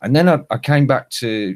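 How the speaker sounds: ordinary talk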